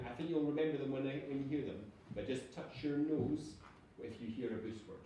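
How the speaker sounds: usual speaking voice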